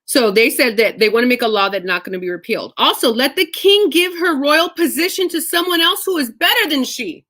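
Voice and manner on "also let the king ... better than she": high, declaiming reading voice; scripture reading. Acted. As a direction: read the verse out boldly.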